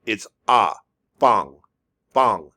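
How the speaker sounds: usual speaking voice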